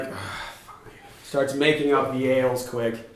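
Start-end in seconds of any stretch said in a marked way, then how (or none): 0.0-0.9 unvoiced, much softer grudging groan; grudging barkeep. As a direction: give in with a tired breath. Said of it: "barkeep is like".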